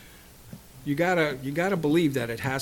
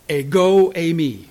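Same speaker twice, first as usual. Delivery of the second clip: ringing declaration; 8 dB louder than usual. Retold or imitated. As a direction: declare it with force. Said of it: "I say unto you".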